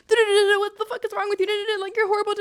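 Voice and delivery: high, loud frantic tirade; berating inner voice. Retold or imitated. Speaker's head attacking them while crying by a tree, berating. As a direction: imitate a mind screaming abuse at itself. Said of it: "my head was still just".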